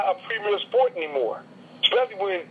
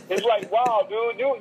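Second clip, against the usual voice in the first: usual speaking voice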